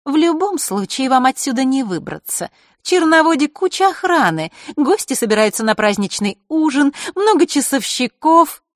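put-on high, loud taunting girl. A girl gloating at the runaways, taunting. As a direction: gloat that they cannot escape. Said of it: "насмешливо покосившись".

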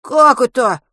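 put-on boy shocked by the news; stunned. A narrator's reading. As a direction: gasp it out in disbelief. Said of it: "ошарашенно".

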